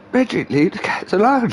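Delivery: fed-up owner scolding dog; exasperated telling off. Retold or imitated. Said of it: "That's a drinking game".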